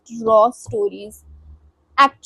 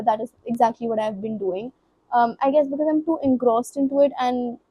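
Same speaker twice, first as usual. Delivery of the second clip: usual speaking voice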